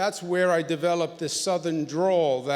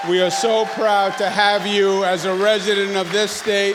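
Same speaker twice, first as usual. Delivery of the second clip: booming, louder tribute to the president; loud proud declaration; 2 semitones higher, 9 dB louder than usual. Real, not acted. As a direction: proclaim it to the whole room.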